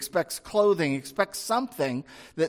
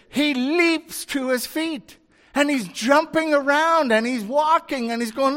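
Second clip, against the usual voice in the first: high-pitched, swelling excitement; excited storytelling; 8 semitones higher, 4 dB louder than usual. Real, not acted. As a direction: build the thrill of the leap and jumping. Excited retelling of the healing.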